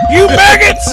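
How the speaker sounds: screaming high drill instructor; drill sergeant. Acted. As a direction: bark at the recruits like a drill sergeant. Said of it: "drill instructor's hat on".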